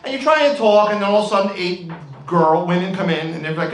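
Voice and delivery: ordinary delivery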